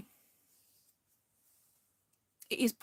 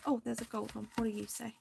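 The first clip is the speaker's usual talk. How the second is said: ordinary talk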